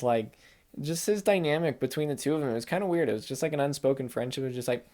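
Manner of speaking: ordinary talk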